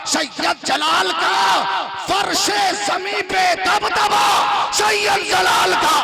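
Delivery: loud, high poetic recitation; recited couplet. Acted. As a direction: declaim the verse to the crowd. Couplet repeated, crowd chants.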